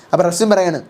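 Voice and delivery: ordinary talk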